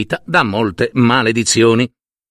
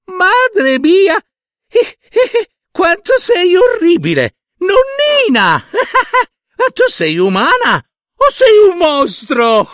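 very high, laughing jeers; mocking laughter; 4 dB louder than usual. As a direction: cackle and jeer at the old woman. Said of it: "si mise a ridere".

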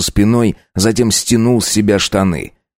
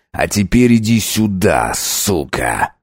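feral vampire; slower than usual. Acted. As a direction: growl the threat at her. Vampire threatens wife.

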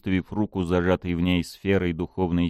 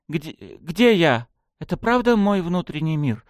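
trembling voice; 8 semitones higher than usual. Acted. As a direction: ask with a quivering voice. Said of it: "спросила она дрогнувшим голосом".

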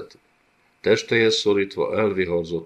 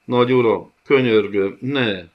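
higher, louder terrified plea; desperate begging; 5 semitones higher, 7 dB louder than usual. Acted. The gocsomul begs the Griff not to blind him. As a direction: beg for mercy in panic.

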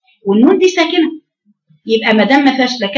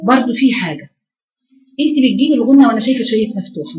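ordinary talk

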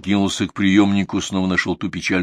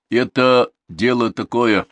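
slow, loud, self-important; raised, measured know-all tone; weighty confirmation; 5 semitones higher, 7 dB louder, slower than usual. A narrator's reading. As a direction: pronounce it with solid authority. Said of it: "солидно подтвердил Пашка".

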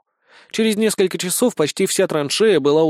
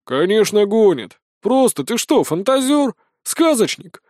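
mocking, higher voiced soldier; scoffing; 6 semitones higher than usual. A narrator's reading. Private mocks the narrator, a light scoff. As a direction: scoff at the new recruit. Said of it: "хмыкнул".

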